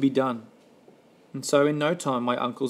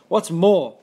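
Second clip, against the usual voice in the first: a louder, higher snap to begin the rebuke; snapped; 4 semitones higher, 7 dB louder than usual. A narrator's reading. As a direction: snap it out, his dander up. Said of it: "snapped the Lorax".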